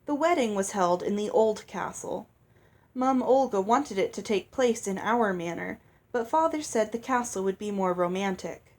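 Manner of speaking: plain reading voice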